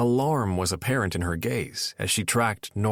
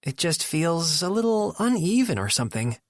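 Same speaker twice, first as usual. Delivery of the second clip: Nora voice; 8 semitones higher than usual. A narrator's reading.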